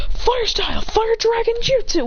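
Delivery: high-pitched attack announcement; technique call. Acted. Fighter calls out his technique.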